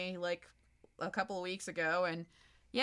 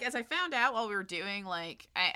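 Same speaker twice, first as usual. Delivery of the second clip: ordinary delivery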